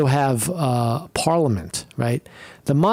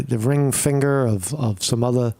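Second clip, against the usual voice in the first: normal talking voice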